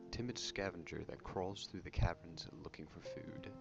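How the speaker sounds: whispered reading of the entry. Acted. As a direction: breathe the quote out unvoiced. Reading hunter entry aloud.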